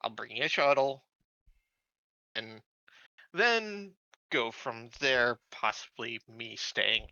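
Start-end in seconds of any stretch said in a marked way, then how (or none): none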